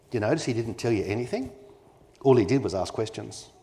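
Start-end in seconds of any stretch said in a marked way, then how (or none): none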